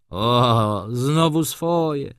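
high, drawn-out, slow O, znowu swoje; exasperated exclamation. A narrator's reading. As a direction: groan it out like you've heard it a hundred times. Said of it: "wykrzyknął młody człowiek".

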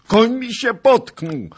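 very high, loud, drawn-out wail of alarm; panicked cry. A narrator's reading. Fleeing nobleman to knight about his horse, high panicked yell. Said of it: "zawołał po raz drugi Zagloba".

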